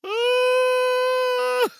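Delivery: high, loud delighted cry at a happy ending; swooning movie-ending exclamation; joyful gasp. Retold or imitated. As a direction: burst out in wonder. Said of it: "and you're like".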